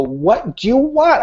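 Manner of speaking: high, slow, angry retold yell; hammed-up angry yell at his daughter; retold screaming. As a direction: bellow it like a dad losing his temper. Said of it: "I said, screaming top of my lungs".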